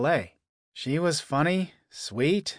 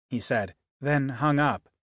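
usual speaking voice

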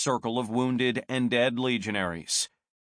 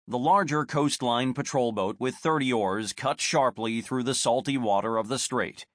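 usual speaking voice

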